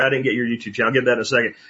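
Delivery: ordinary talk, nothing marked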